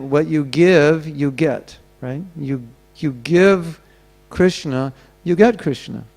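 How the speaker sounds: slow, with weight on give and get; slow, with each give and get stressed; slow emphatic maxim. Shown as a demonstration. Preacher driving home his point, slow and stressed. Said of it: "And I made the point".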